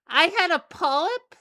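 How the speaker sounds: high, loud incredulous question; shocked. Acted. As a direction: blurt it out in disbelief. Character shocked at news.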